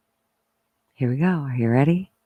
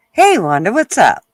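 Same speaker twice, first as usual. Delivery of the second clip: loud, bright hello; calling out greeting; 4 semitones higher, 7 dB louder than usual. Real, not acted. Greeting Wanda in the live chat, loud and bright. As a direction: call out to a friend.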